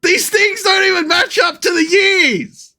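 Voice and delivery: exasperated yell at the dates; indignant shout. Real, not acted. Ranting at bogus dates.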